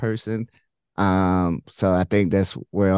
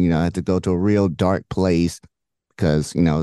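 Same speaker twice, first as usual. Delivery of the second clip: usual speaking voice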